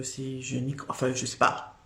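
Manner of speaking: normal talking voice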